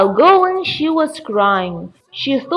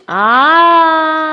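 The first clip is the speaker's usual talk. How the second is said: a short, higher angry yell; witch's angry cry; 4 semitones higher, 3 dB louder than usual. A narrator's reading. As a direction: let out the witch's rage in one cry. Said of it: "She shouted".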